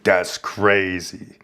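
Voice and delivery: very slow, stretched-out retort; drawn-out disbelief. Retold or imitated. Fellow inmate reacting to the insult, imitated slow drawl. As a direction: imitate him dragging out each word. Retold stunned reply of inmate.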